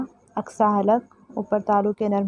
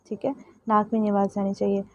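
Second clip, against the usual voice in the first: normal talking voice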